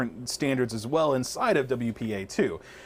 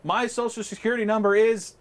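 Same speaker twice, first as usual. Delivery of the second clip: raised, higher-pitched mock yell; 8 semitones higher than usual. Showing listeners how broadcasting leaks private information, mock yelling out. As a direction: call it out as if announcing it to everyone. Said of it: "standing there yelling out".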